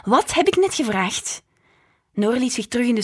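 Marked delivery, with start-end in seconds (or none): 0.0-1.4 higher, louder sharp rebuke; angry mother. A narrator's reading. Mother snapping at her daughter in the mirror, stern. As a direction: scold her with fire. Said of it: "ogen schoten vol vuur".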